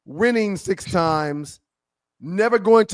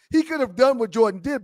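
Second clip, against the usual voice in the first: usual speaking voice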